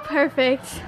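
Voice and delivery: ordinary talk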